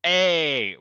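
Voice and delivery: loud protest. Real, not acted. Protests the swearing.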